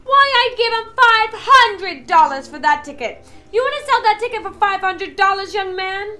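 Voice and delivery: shouting woman. A narrator's reading. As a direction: outbid him indignantly. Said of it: "shouted a woman".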